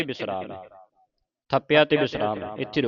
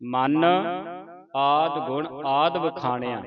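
ਮਨ held alone, a long rest before ਆਦ; pause after ਮਨ. Shown as a demonstration. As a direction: rest on ਮਨ, then read evenly. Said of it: "ਮਨ ਤੇ ਵਿਸਰਾਮ ਲਾਉਣਾ".

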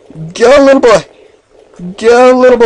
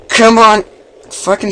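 frustrated shout; 11 semitones higher than usual. Real, not acted. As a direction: snap at the game. Frustrated louder repeat.

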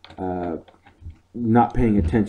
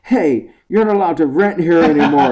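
retold laughing yell; 7 semitones higher, 6 dB louder than usual. Randall chasing customer, retold.

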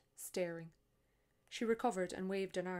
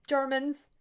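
higher, louder cry of discovery; triumphant exclamation; 7 semitones higher, 13 dB louder than usual. Acted. Maureen exclaiming to the tourists, triumphant and loud. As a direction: announce it like you cracked the case.

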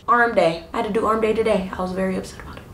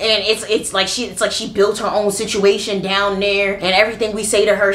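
ordinary delivery

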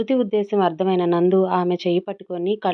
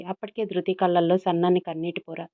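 ordinary delivery, nothing marked

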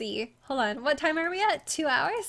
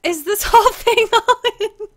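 real laughing disbelief; 5 semitones higher, 9 dB louder than usual. Real, not acted. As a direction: question broken by laughter, higher and louder. Stunned at size of region.

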